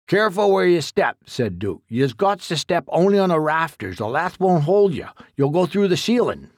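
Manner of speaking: put-on folksy old man warning his helper; rustic old exterminator. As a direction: play a blunt country codger giving orders. Duke warns Jack in attic.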